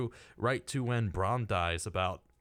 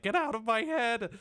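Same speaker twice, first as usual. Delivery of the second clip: mock exclamation in a much higher pitch; John Malkovich exclaiming; 13 semitones higher than usual. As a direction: pitch it way up, a playful retold protest. Acting Malkovich's protest in film retelling.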